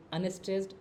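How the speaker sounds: light unstressed beat on unstressed; demo unstressed. Shown as a demonstration. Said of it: "पाँच आयम मतलब क्या".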